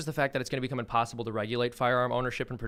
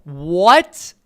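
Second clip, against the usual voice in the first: very loud, high, rising disbelief; stunned reaction; 5 semitones higher, 16 dB louder than usual. Real, not acted. Host hearing what Greta said, stunned outburst. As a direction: blurt it out in real shock. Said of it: "I love your reaction to this".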